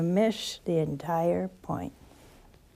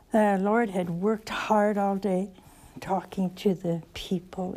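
ordinary delivery

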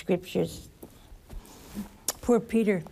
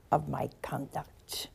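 whispered; 6 semitones lower, 8 dB quieter than usual. Acted. Preacher reciting the psalm to the congregation, whispered. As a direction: drop to a slow whisper.

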